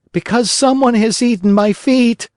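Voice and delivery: put-on a desperate boy explaining; Pinocchio wailing. A narrator's reading. Pinocchio answers his father.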